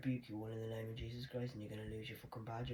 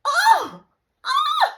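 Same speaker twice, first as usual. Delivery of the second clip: piercing high shriek; sudden loud high yelp; 23 dB louder than usual. Real, not acted. Ranting alone, suddenly shrieks very high and loud.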